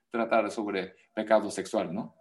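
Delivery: ordinary talk, nothing marked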